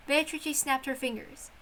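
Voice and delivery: story narration. A narrator's reading. Narrator describing the witch's tea party, soft and wondering. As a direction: softly read fairy-tale narration.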